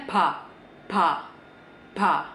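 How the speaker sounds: "ba" nearly "pha", lightly puffed; B-P blend, slight H. Shown as a demonstration. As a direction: say "ba" between B and P with a little breath. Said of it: "Kadang rada ada kayak H-nya dikit".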